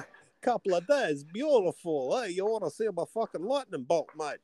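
put-on high-pitched comic Greek accent; Zeus accent. Mocking the Thor performance for a co-host, comic Greek accent. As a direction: ham up a cheesy Greek wide-boy accent. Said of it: "After his Con the Fruitier accent on Thor".

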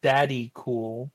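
"Daddy Cool" drawn out slowly, word by word; slow clarification. Real, not acted. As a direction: spell out "Daddy Cool" slowly for someone who missed it. Repeats misheard name slowly for listener.

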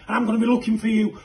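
ordinary delivery

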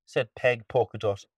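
usual speaking voice

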